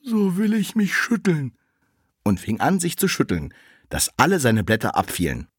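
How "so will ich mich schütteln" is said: much higher, slow little tree voice; the little tree asks the burning dung heap, high and slow. Acted. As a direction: ask gently and slowly in a high voice. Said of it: "Da sprach das Bäumchen".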